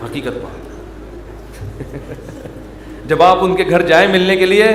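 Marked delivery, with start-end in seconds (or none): 1.6-2.6 soft breathy laugh. Real, not acted. Audience reaction to joke.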